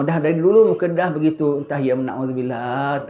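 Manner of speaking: ordinary talk, nothing marked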